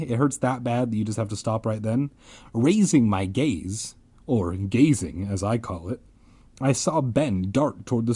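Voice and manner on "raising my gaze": ordinary talk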